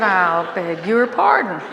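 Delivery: haughty put-down with a laugh; indignant refusal. Retold or imitated. Wife telling husband she won't wear homemade clothes, mock indignant.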